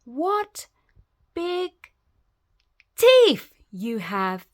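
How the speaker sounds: ordinary delivery, nothing marked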